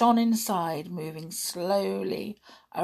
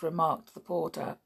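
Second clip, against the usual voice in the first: usual speaking voice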